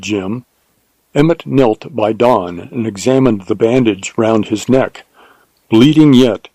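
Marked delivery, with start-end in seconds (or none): none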